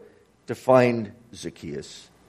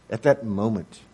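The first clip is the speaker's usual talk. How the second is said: normal talking voice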